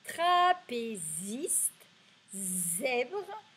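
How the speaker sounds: "trapéziste" and "zèbre" stretched, with buzzed z; slow recap, clear Z. Shown as a demonstration. Teacher repeating the Z words, "trapéziste", "zèbre" slowly. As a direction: draw out "trapéziste" and "zèbre" syllable by syllable. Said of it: "Donc je répète".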